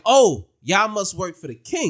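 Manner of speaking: loud, slow, drawn-out realization; mock excited exclamation. Villager greeting the strangers she sees, mock excited.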